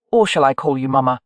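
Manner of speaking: "Mama" with mocking stress on its last syllable; cheeky question, final syllable of "Mama" mockingly stressed; mocking emphasis. A narrator's reading. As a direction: lean pointedly on the end of "Mama". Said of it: "He put a mocking emphasis on the last syllable of the word".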